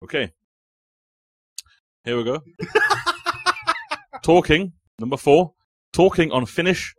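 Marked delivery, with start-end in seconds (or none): 4.2-6.9 raised calling voice. Real, not acted. Host calls over chatty players.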